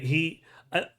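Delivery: ordinary delivery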